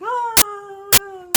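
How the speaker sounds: high, loud startled shriek; old lady shriek. Retold or imitated. The old lady seeing the monster, a loud high startled shriek. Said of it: "she's like".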